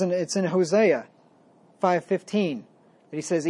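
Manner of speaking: ordinary talk, nothing marked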